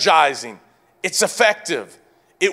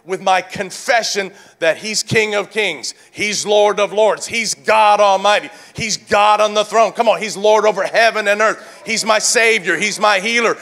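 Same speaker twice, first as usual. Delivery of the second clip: full-force preaching; 5 semitones higher, faster than usual. Real, not acted. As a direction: preach it with driving, rhythmic force.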